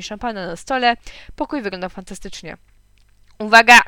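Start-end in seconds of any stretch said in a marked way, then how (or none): 3.4-3.8 sudden loud warning cry; shout. A narrator's reading. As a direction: call out to alert everyone. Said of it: "krzyknęła mama".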